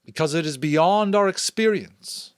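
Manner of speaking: solemn elder's public proclamation; chief elder's address. Acted. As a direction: speak up to the whole community with ceremony.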